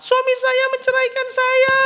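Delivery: very high, slow, hammed-up sobbing wail; mock wailing. Wife reporting her divorce to ustadz, mock wailing. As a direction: draw it out as a loud fake bawl.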